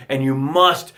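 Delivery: normal talking voice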